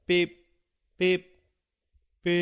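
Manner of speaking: flat, even beep beep beep like a monitor; heart monitor beeps. Retold or imitated. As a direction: mimic a flatlining heart monitor. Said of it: "they sound like a heart monitor flatlining".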